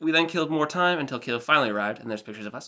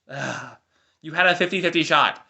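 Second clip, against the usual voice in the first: normal talking voice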